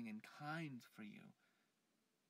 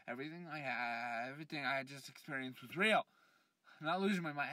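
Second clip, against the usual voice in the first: louder, pushing hard that it was real; agitated insistence; 2 semitones higher, 10 dB louder than usual. Acted. Man loudly insisting to friends his vision was real. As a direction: insist loudly you're not crazy.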